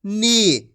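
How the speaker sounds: नी with the ee held long; drawn-out long ee. Shown as a demonstration. Teacher answers for the class, long ee drawn out. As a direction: stretch the vowel of नी. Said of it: "खींच के पढ़ा जाता है".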